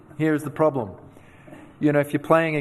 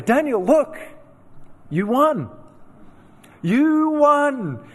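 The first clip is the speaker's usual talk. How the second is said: high, coaxing parent voice, louder on the last you won; soothing parent; 11 semitones higher, 4 dB louder, slower than usual. Retold or imitated. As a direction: play the parent humoring a crying child. Parents consoling crying son.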